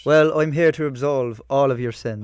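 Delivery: put-on a light Irish accent, priestly and welcoming. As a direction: play a kindly Irish priest offering absolution, lightly. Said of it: "we need an Irish priest".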